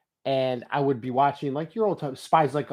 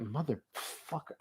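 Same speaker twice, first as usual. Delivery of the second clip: barely voiced motherfucker; muttered curse; 2 semitones lower, 15 dB quieter, slower than usual. Real, not acted. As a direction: curse it under your breath. Fails, curses quietly.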